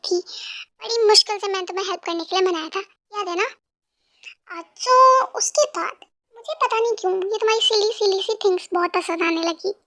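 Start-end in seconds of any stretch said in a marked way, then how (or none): none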